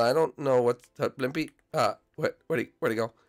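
usual speaking voice

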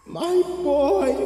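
high, eerie hag croon; creepy witch voice. Acted. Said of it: "she said".

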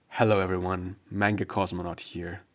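ordinary delivery

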